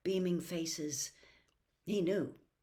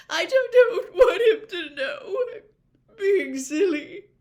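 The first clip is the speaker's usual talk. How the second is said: high, loud crying, slowing at the end; gulping tears; 14 semitones higher, 15 dB louder, slower than usual. A narrator's reading. Missus Weasley begs Harry not to tell Arthur, crying. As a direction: fight the sobs while apologising. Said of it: "was gulping now".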